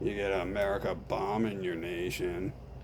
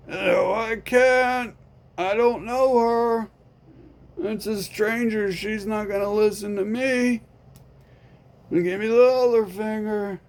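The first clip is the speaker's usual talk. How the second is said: a higher, offhand friend voice; lightly voiced friend; 12 semitones higher, 7 dB louder than usual. Friend refusing to help, mildly raised voice. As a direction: shrug it off, unbothered.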